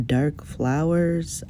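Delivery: usual speaking voice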